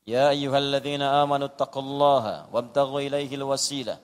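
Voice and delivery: measured, sustained Arabic recitation; Quran recitation. Real, not acted. Preacher quoting scripture to congregation, recited.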